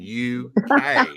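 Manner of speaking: "U K" spelled letter by letter, high and pointed; each letter of "U K" hit slowly and hard; spelled out emphatically. Shown as a demonstration. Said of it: "what part of those two letters did you not understand".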